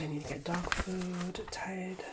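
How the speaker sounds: ordinary talk, nothing marked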